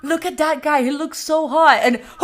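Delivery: loud, high acted outburst; hammed-up yelling about a stranger; screaming in public. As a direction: blurt it far too loudly. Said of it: "they're screaming".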